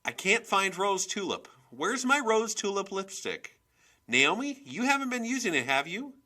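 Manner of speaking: high, loud, frantic yell; exasperated, accusing shout; yelling from afar. A narrator's reading. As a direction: holler through the house for your lipstick. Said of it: "she yelled".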